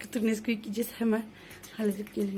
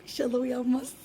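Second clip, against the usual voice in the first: usual speaking voice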